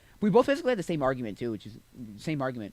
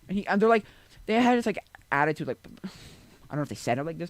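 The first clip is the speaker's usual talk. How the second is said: usual speaking voice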